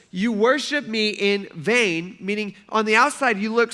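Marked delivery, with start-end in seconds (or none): 0.1-2.2 slow, weighty Jesus quote. Retold or imitated. To the leaders, Jesus quoted slowly. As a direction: lay each word down slowly. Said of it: "He says".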